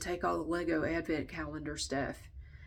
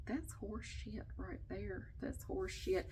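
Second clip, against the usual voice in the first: much softer, slow grumble to herself; muttered disgust; 11 dB quieter, slower than usual. Real, not acted. Complaining to viewers about the holiday rule, soft disgusted mutter. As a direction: say it under your breath, fed up.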